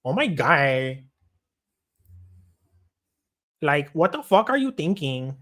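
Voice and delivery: lightly voiced exasperated woman; mock-scold as the torta character; torta woman impression. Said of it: "my fucking torta impression".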